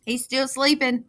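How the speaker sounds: usual speaking voice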